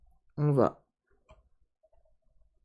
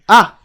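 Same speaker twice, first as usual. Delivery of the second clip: sudden loud high yelp; a sharp startled shout; shocked cry; 8 semitones higher, 17 dB louder than usual. Acted. Reacts to smashing the object.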